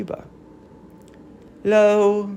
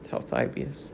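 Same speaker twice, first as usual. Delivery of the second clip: ordinary delivery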